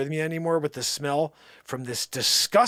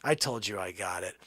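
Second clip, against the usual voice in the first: cocky hero; 9 semitones lower, 6 dB quieter than usual. Acted. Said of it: "and he says".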